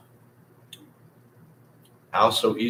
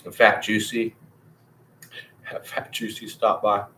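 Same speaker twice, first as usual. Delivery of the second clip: ordinary talk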